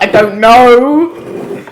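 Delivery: long, drawn-out puzzlement; baffled. Retold or imitated. Listener failing to place an Irish accent, baffled drawl. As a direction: stretch every word, at a loss.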